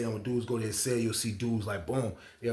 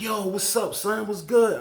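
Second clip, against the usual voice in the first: higher-pitched, imitating an eager greeting; acted hearty greeting; 8 semitones higher, 4 dB louder, slower than usual. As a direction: greet a newcomer like a hustler, pitched up. Acted greeting of approaching inmate.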